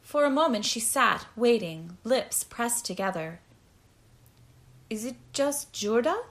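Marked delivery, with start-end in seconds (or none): 4.9-6.2 tentative question, rising at the end; hopeful. A narrator's reading. Prisoner guessing what the drug is, hopefully. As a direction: ask with a touch of hope. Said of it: "she asked hopefully".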